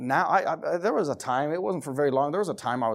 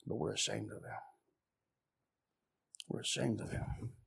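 unvoiced, much softer hushed line; whispered; 6 semitones lower, 14 dB quieter than usual. Preacher admits the church's shame in a hushed whisper. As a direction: drop to a near whisper of shame.

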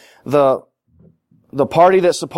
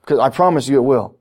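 normal talking voice

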